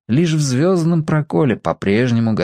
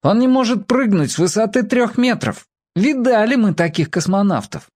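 put-on scornful child; 10 semitones higher than usual. A narrator's reading. Children jeer at the boy.

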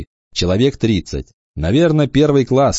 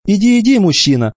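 teasing; 12 semitones higher, 4 dB louder than usual. A narrator's reading. Mother sending son off.

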